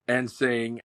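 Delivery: ordinary delivery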